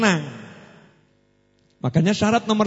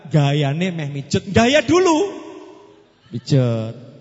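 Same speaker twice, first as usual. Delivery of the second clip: ordinary talk, nothing marked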